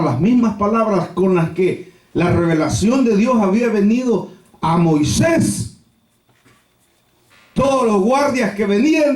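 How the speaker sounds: ordinary delivery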